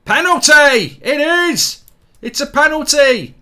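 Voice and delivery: loud, high yell of joy; real fan eruption, loud and high; excited shout. Real, not acted. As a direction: burst out as the ref points to the spot. Penalty given, fan erupts.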